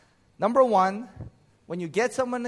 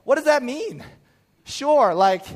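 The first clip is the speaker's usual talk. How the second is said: higher and louder mock exasperation; exasperated outburst; 8 semitones higher, 9 dB louder than usual. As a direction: play up the frustration with your wife. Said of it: "finally I was like".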